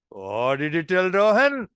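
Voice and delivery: slow teasing question with a lilt; amused, expecting mischief. Shown as a demonstration. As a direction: ask as if the rascal did something funny. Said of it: "rascal to have done something funny".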